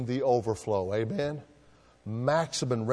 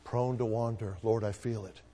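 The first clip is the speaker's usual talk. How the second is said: low, soft and reverent; hushed hymn line; 3 semitones lower, 7 dB quieter than usual. Acted. Preacher reciting the hymn to his congregation, softly. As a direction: let the line fall quietly, like a prayer.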